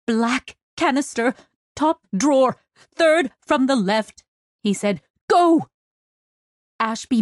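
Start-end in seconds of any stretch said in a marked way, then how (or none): 0.0-4.2 higher-pitched urgent list; doctor's tense emergency directions; distressed instructions. Acted. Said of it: "A dozen distressed sounds burst". 5.3-5.7 high, loud burst; sharp emergency order; urgent command. Acted. Doctor sends captain running, emergency.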